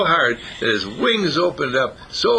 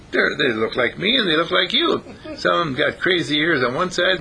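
higher-pitched, amazed rabbit voice; marvelling rabbit; 6 semitones higher than usual. Acted. Daddy Rabbit describes his babies, amazed and pitched up. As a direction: ramble in wonder as a rabbit, pitched up.

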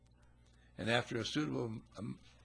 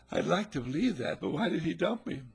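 upset bride; 7 semitones higher, 6 dB louder than usual. Acted. Jilted bride protesting.

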